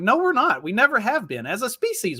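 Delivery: high, swooping contradiction; emphatic rebuttal. Retold or imitated. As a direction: shoot the idea down, pitch leaping up. Retelling own rebuttal to friend.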